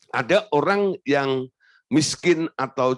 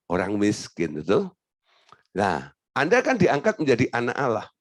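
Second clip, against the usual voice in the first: ordinary talk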